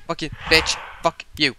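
loud, heated cursing; angry swearing. Real, not acted. Player telling the monster to back up, angry swearing. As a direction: swear loudly at the thing scaring you.

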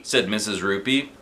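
ordinary delivery, nothing marked

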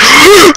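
sharp, high gasp of fright; frightened gasp. Real, not acted. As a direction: suck in air, panicked. Ghosts appear in horror game.

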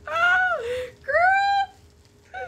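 high, loud crying squeal; excited scream. Real, not acted. Seeing the neon palette, she squeals at her friend. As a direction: shriek with tearful joy. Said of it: "I'm screaming".

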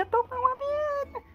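high strained lament; anguished outcry. Acted. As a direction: let the words climb high and stretch. Ranting against his own gang.

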